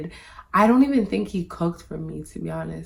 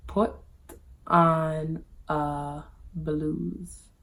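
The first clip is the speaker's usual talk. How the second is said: very slow, halting delivery of an order; imitating the man; 3 dB quieter, much slower than usual. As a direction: drag each word out with pauses. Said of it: "The man says".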